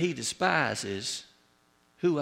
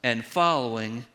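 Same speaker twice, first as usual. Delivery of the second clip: preaching. Acted. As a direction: drive the warning home to the flock. Preaching climax.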